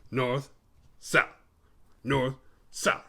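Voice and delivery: imitated terse "North, south", each word set apart; lightly mimicked character saying "north, south"; Mick. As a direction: say "North" and "south" as separate short words, mimicking him. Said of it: "This is exactly how he says it too".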